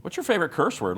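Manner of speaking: P=A; excited high question. Shown as a demonstration. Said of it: "Let's follow that up with a great question".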